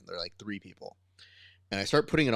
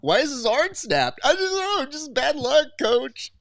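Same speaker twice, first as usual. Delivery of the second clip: much higher, louder mock whine; whiny student excuse; 6 dB louder than usual. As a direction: play the guilty kid pleading innocence.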